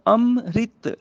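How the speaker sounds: ਅੰਮ੍ਰਿਤ slowly as "am—rit"; i after r. Shown as a demonstration. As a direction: split ਅੰਮ੍ਰਿਤ, sounding "rit". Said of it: "ਰਾਰੇ ਨੂੰ ਸਿਹਾਰੀ ਨਾਲ". (ਅੰਮ੍ਰਿਤ ਨੂੰ "ਅੰਮ-ਰਿਤ" ਬੋਲੋ)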